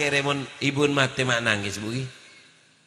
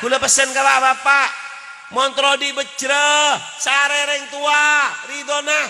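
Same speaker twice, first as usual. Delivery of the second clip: preaching at full force; 8 semitones higher than usual. Acted. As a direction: urge the fathers forcefully. Preacher exhorts fathers. (khotbah berapi-api)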